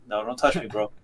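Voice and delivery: low, quiet, unmoved warning; stoic. Retold or imitated. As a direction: imitate someone stoic, soft and low. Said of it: "just, like, stoic as fuck".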